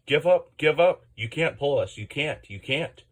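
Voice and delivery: loud high jeering call; taunting call. A narrator's reading. Trucks taunting James up the hill, loud jeering call. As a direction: jeer it out to wear him down. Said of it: "called the trucks".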